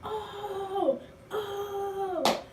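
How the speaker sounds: very high, soft, laughing "oh"; excited cheering. Retold or imitated.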